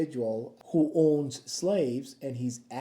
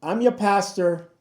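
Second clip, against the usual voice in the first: ordinary talk, nothing marked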